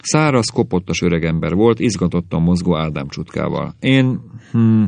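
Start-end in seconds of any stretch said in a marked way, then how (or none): 3.8-4.8 hesitant, slightly raised voice with a hum; mildly bashful, faltering start; timid. A narrator's reading. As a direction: offer yourself shyly. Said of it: "mondta félénken".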